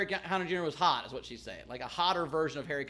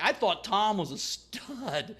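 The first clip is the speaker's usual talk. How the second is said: slow disappointed quip; 2 semitones higher, much slower than usual. Retold or imitated. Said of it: "I'm like".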